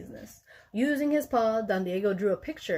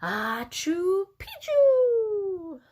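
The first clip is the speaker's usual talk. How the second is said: high, very slow sneeze blended into words; half sneeze, half word, drawn out high; sneeze-spoken password; 10 semitones higher, 4 dB louder, much slower than usual. A narrator's reading. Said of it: "half sneezed and half spoke".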